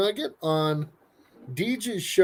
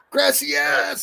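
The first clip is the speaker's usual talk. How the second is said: calling out; 10 semitones higher than usual. Real, not acted. Greeting arriving guest loudly.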